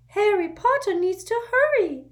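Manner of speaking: urgent little servant squeaking; an anxious house elf waking his master; squeaky house elf. A narrator's reading. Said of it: "squeaked Dobby".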